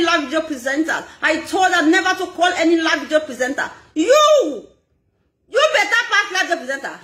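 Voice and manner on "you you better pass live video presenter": mock retort. Voicing someone's retort.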